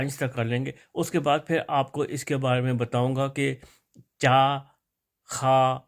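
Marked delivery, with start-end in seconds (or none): 4.2-4.6 "چا" with a clear ch, drawn out loud; "ch" sound demo. Shown as a demonstration. Teacher shows where the sound comes from, demonstrating "چا". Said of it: "یہ کہاں سے کیسے آواز نکلتی ہے". 5.2-5.8 "خا" with a throaty kh and long aa; "kh" sound demo. Shown as a demonstration. Teacher shows where the sound comes from, demonstrating "خا". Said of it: "یہ کہاں سے کیسے آواز نکلتی ہے".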